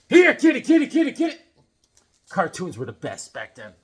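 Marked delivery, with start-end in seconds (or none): 0.1-1.4 high, loud cat call; cartoon-style kitty call. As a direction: call the kitty across the yard.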